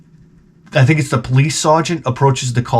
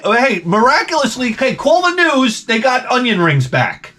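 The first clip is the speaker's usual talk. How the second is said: mock excitement; 11 semitones higher than usual. Real, not acted. Mock celebration of onion rings.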